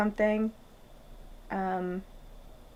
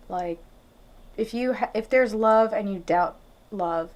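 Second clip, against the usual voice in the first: normal talking voice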